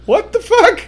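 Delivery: high-pitched mock-startled outburst; incredulous reaction. Reacting to a friend's outrageous remark, incredulous. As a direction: shoot it up high in disbelief.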